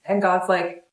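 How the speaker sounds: ordinary delivery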